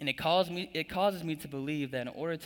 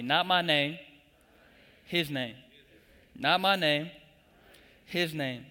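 Not my name louder, his name dropped back; call for the congregation; 2 semitones higher, 5 dB louder than usual. Shown as a demonstration. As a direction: punch the word my, then settle on his. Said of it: "Say that with me".

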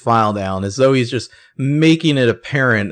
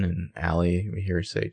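normal talking voice

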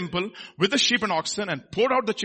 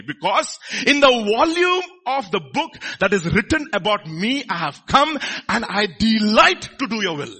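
loud, emphatic acted plea; impassioned, raised-voice devotion; crying out in prayer; 4 semitones higher, 5 dB louder than usual. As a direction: declare it forcefully to God. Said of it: "with loud cries and tears".

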